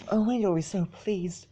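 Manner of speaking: high, soft, gentle delight; happy Fluttershy. A narrator's reading. Fluttershy tells Macintosh he'll be pleased, high and soft. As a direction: lift the voice up softly with joy. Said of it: "said happily".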